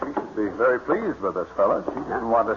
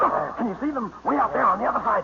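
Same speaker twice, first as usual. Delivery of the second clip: excited; 12 semitones higher, faster than usual. Acted. Pointing out distant ducks.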